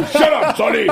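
high, laughing shut-up; mock-annoyed laughing reply; laughing retort. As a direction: brush off the teasing, laughing.

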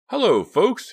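high, loud, jolly greeting; big sing-out hello; cheery yell. A narrator's reading. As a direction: call out cheerily across the store. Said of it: "he yelled cheerily".

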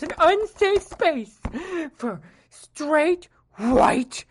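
high, loud whiny nerd rant. Acted. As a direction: screech like an outraged basement nerd. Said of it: "my throat's all dry from that nerd voice".

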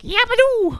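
very high, loud cartoon squeal; squeaky Tails voice. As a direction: squeal it like a hyper cartoon fox. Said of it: "Tails is like".

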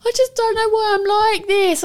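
put-on high-pitched upset kid's wail; retold crying child. Son upset after storming off, imitated high tearful protest. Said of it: "like, crying his eyes out".

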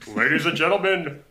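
announcer voice. Acted. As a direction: introduce the band like a stage announcer. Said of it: "announcer on S N L".

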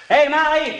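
loud call across the house; husband calling to wife in another room, loud call. Acted. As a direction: call her from the kitchen. Calling to Molly in bedroom.

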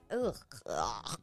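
low, soft groan of disgust. Real, not acted. Recalling crying over schoolwork, real disgusted groan. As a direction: groan low, revolted by the memory. Disgust at ugly homeschool memory.

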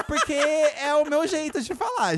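alto, agudo e arrastado; gritando de brincadeira. Pretend. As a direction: projete a voz em tom empolgado, esticando. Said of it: "por que que o [name] tá gritando?". (falando alto, quase gritando, de brincadeira)

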